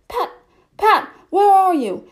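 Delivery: high, loud angry shout; angry call. Acted. Rabbit shouting angrily for his servant Pat. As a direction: yell for him in a temper. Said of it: "Next came an angry voice".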